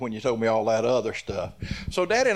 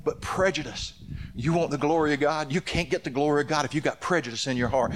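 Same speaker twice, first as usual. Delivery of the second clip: preaching; 3 semitones higher than usual. Acted. Pastor urging the church against prejudice, preaching. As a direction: press the point home to the congregation.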